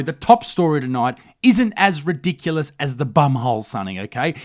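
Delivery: ordinary delivery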